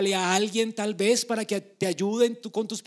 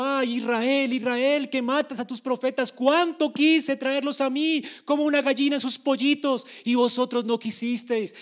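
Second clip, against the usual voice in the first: voiced lament; 5 semitones higher, 5 dB louder than usual. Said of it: "el Señor se lamenta".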